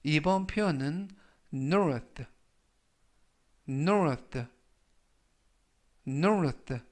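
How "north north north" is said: north said clearly, three times; teacher modelling north; model pronunciation. Shown as a demonstration. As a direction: repeat north carefully for learners. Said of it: "라고 발음하시면 되겠고요".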